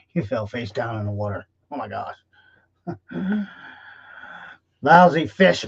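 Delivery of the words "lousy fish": a loud, annoyed grunt of a line. Acted. Fisherman who caught nothing grunts, loud and annoyed. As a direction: spit it out like a fed-up fisherman. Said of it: "Mister Wilson grunted".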